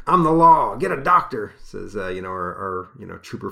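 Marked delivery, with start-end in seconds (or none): 0.1-1.5 tough cop. Acted. Reading the trooper's line.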